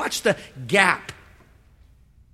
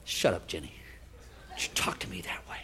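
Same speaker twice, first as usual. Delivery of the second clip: low, hushed angry grumbling; muttered grumble; 14 semitones lower, 15 dB quieter than usual. Acted. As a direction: mutter it under your breath, offended. Naaman snapping at servant girl.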